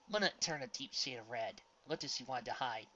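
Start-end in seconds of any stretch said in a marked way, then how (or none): none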